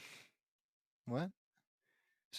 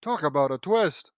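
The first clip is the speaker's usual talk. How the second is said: loud, sarcastic proclamation; mock amazement; 10 dB louder than usual. Reader mocks the story's supposed twist, loud sarcastic exclamation. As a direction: belt it out as feigned astonishment.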